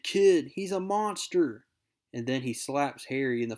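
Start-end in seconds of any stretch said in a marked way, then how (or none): 0.0-1.7 shouting. Acted. Shaw shouts Harry down.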